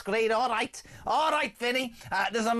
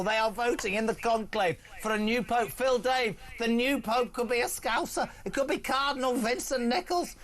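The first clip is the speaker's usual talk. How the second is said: normal talking voice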